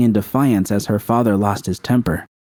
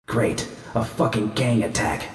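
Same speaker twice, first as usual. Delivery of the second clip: muttered curse; 7 dB quieter than usual. Acted. V mutters during fight.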